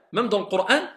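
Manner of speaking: ordinary delivery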